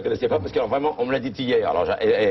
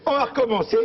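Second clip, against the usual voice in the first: put-on laughing old man; 11 semitones higher than usual. Husband laughing.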